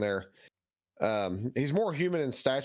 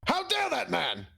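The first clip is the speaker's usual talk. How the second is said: righteous paladin declaration; 10 semitones higher than usual. Acted. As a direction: condemn him like a righteous crusader. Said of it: "throw my Crusader voice out there".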